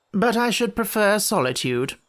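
high, lofty polite refusal; courteous. A narrator's reading. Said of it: "said the lamb courteously".

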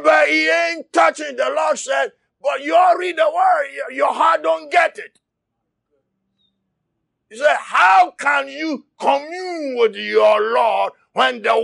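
shouted sermon, driving hard; impassioned preacher; fiery preaching. Acted. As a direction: preach it at full force to the church. Preaching at full force.

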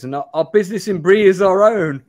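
mock-defiant hobbit line; curt refusal to answer; quoting Frodo. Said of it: "I should say what Frodo says".

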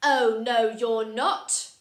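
loud, bossy demo refusal. Shown as a demonstration. Teacher showing viewers George's bossy refusal, loud. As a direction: show the children a bossy no. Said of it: "use your bossy voice to say".

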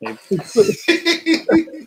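high, delighted laugh; real burst of laughter. Real, not acted. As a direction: crack up for real. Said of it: "I start laughing".

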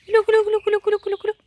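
high sing-song calling to poultry; high repeated call to the turkeys. Real, not acted. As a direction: call the birds in with a high chant.

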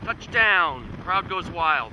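loud, thrilled cheer; excited shout. Real, not acted. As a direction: cheer the touchdown like a sportscaster. Tornado touches down on camera.

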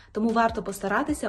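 usual speaking voice

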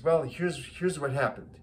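put-on older Italian man with an accent. Italian widower explaining the funeral to a stranger, Italian accent. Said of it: "Italian man says".

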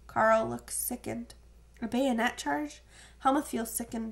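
ordinary delivery

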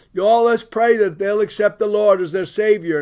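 calling out. Retold or imitated. Preacher urging the church to pray, calling out loudly. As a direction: call the congregation to prayer in a big voice.

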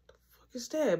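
startled whisper in the dark. Retold or imitated. Hearing a noise in the dark house, alarmed whisper. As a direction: whisper it so nobody wakes.

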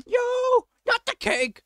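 very high, loud yelp; high-pitched cry of protest; panicked scream. Real, not acted. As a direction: shriek in alarm for the cake. Panics as the cake flies off.

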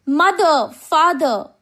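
loud, high call for lost parents; a child's scared call, acted by a narrator; frightened cry. A narrator's reading. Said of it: "crying in fear".

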